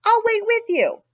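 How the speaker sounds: high, eager cow voice; Cow's voice. Acted. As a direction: pitch it up and offer to wait. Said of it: "said Cow".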